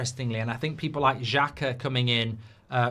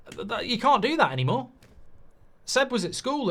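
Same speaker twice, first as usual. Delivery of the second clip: ordinary delivery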